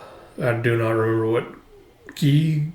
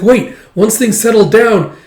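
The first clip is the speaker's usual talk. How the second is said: higher and louder, cutting in; urgent call; 8 semitones higher, 7 dB louder than usual. Retold or imitated. Officer cutting in on the king, loud and urgent. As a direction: jump in to stop him.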